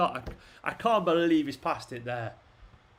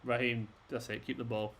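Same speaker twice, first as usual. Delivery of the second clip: usual speaking voice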